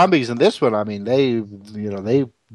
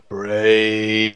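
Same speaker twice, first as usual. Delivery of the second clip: zombie voice. Retold or imitated. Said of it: "zombie voice would be like".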